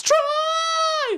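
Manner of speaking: very high yelled name; shrill mock shout. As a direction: scream his name from the crowd. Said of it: "Gabrielle goes".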